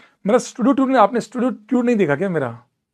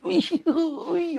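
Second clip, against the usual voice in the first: high, drawn-out "ooee, ooee"; mock yelps of pain; 10 semitones higher, 4 dB quieter, much slower than usual. Teacher joking about slipper beatings, mock pained yelps. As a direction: squeal playfully as if being smacked. Acting out getting hit with slippers.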